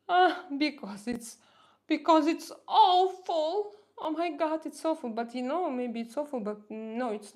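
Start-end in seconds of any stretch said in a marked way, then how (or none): 0.1-5.1 shocked dismay. Real, not acted. Reacting to a hateful comment.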